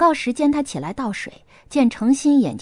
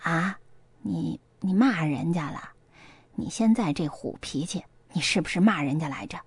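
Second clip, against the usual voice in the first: low, soft, halting worry; hushed anxious questioning; worried; 5 semitones lower, 7 dB quieter than usual. A narrator's reading. Mother fearing her daughter cursed someone, quietly worried. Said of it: "秀蜜又担忧起来".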